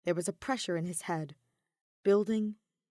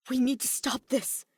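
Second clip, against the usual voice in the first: ordinary delivery, nothing marked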